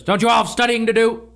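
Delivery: a teacher snapping at loitering pupils; stern scolding. Retold or imitated. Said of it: "there's a moment where he goes".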